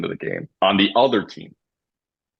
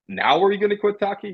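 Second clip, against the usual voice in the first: retold taunt; 12 semitones higher than usual. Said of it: "And I go".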